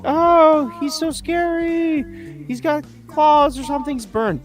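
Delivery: very high, drawn-out whiny mock of scared children; mocking kids. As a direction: mimic bratty kids shrieking about the monster. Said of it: "all the kids are like".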